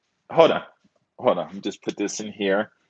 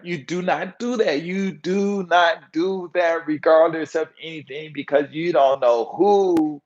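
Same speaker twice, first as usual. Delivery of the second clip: slow, raised-pitch insistence; emphatic warning; 6 semitones higher, slower than usual. Real, not acted. Warning against insulting locals in their bar, slow emphatic insistence. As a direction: lay down each word firmly.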